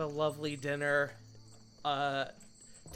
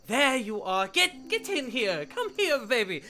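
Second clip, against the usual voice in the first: high, loud, excited parent greeting; gushing, high-pitched welcome; Kana; 9 semitones higher, 7 dB louder than usual. Acted. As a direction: cry out a big welcome at the door. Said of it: "Kana answers the door".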